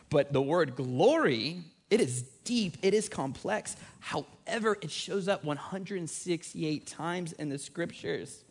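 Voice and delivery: high, measured preaching delivery; emphatic preaching. Real, not acted. Preacher teaching the congregation about God's glory, in emphatic preaching. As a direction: lift the pitch and stretch the weighty words.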